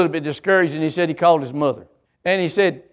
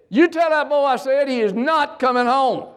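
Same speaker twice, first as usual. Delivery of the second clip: insistent dad; 9 semitones higher than usual. Retold or imitated. Dad across the room to mom, high insistent holler. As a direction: holler it as Dad, leaning on "not". Dad overrules crying mother and son.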